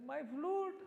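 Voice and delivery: Krishna crying out as his flute is kicked away; dismayed cry. Retold or imitated. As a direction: yelp as the flute rolls away.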